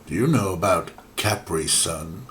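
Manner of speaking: normal talking voice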